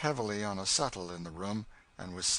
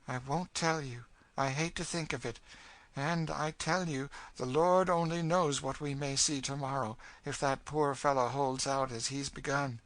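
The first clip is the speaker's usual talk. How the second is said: higher, intense storytelling in character; grim, bitter woman; 6 semitones higher than usual. Acted. Cassie telling the girl of horrors here, grim bitterness. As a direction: warn darkly, voice raised in pitch.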